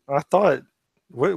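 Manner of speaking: ordinary delivery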